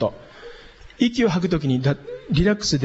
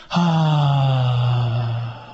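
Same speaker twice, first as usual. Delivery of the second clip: long, even "haa" on one steady low note; relaxed held tone; 4 semitones lower than usual. Shown as a demonstration. Teacher showing diaphragm breath to students, relaxed. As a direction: let the voice ride the out-breath. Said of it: "音は、高さはいいんですね".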